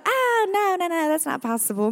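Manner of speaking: sudden high yelp of disbelief; incredulous denial. Retold or imitated. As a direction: refuse the idea outright. Kids rejecting divorce idea.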